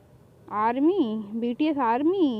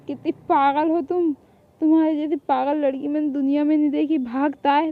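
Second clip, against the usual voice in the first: usual speaking voice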